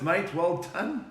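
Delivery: ordinary talk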